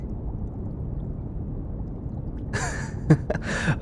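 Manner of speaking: soft, breathy teasing laugh; quiet giggling. Acted. Researcher teasing the pouting bodyguard, quiet giggle. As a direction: laugh softly at someone's pout.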